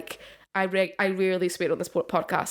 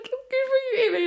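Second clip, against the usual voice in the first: high, bright cheering on; chirpy cheer. Retold or imitated. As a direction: squeak out encouragement. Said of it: "And I was like".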